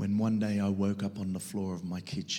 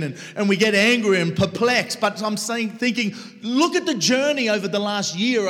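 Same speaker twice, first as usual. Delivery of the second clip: loud, higher, impassioned sermon voice; full-force preaching; 11 semitones higher, 11 dB louder than usual. Acted. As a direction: preach it hard to the crowd. Preacher drives the point home.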